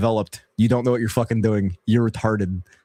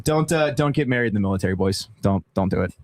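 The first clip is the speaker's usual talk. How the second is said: ordinary talk, nothing marked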